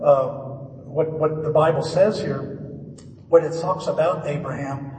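ordinary talk, nothing marked

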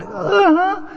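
high, tight mock uh-huh; barely contained acknowledgement, hammed up; strained listening noise. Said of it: "and I'm going".

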